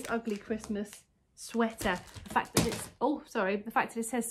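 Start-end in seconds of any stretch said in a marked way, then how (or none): none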